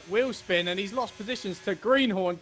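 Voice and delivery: ordinary talk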